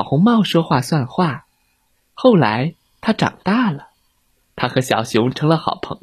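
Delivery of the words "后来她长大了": plain reading voice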